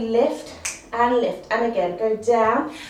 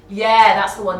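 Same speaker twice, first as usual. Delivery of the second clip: excited praise; 2 semitones lower, 9 dB louder than usual. Real, not acted. Instructor praising the class.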